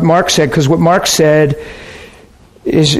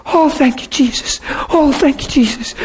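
tearful thanks; 8 semitones higher, 3 dB quieter than usual. Retold or imitated. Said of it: "She come running around the corner and said".

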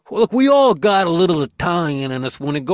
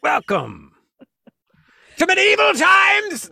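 mock herald; 14 semitones higher, 4 dB louder, slower than usual. Mock grand welcome.

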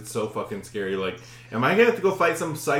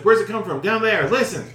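higher, louder re-enacted exchange; urgent; 7 semitones higher, 5 dB louder than usual. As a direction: urgently direct someone toward a noise. Acted urgent exchange about the scream.

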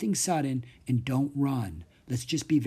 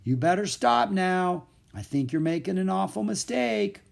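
raised, higher-pitched warning; high, carrying warning call; 7 semitones higher than usual. A narrator's reading. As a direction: call out over engine noise. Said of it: "he called down to the pair of thieves".

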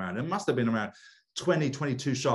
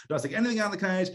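quick amused repeat; 8 semitones higher, much faster than usual. Real, not acted. Repeating the rule.

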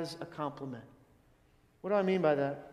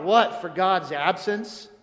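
blurted question; 5 dB louder, slower than usual. Retold or imitated. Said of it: "Larry says he blurted out".

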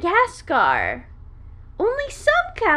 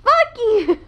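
yelling; 7 semitones higher, 7 dB louder than usual. Real, not acted. Playful yelling at friend.

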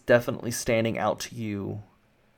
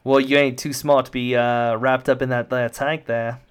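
mocking henchman voice; taunting guard; 8 dB louder than usual. Guard taunts captive wrapped up in the tank, jeering. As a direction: needle the captive as a cocky guard.

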